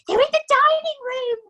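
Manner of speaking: wife alarming the family; panicked mother. A narrator's reading. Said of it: "hissed the mother".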